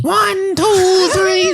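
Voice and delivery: very high silly counting voice; dopey cartoon bellow. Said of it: "the guy doing the caveman voice".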